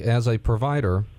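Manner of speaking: usual speaking voice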